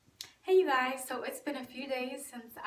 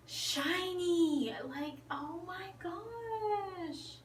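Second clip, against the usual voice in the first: high, long-drawn wail of delight; mock about-to-cry gushing; 6 semitones higher, much slower than usual. Gushing to viewers over her shiny hair, mock tearful awe. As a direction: stretch it out high, joking on the edge of tears. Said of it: "I'm about to cry".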